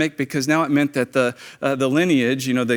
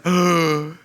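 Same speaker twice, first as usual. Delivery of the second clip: acted sharp gasp of horror; shocked gasp; 2 semitones higher than usual. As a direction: gasp at the sight of blood. Acts out shock at the blood.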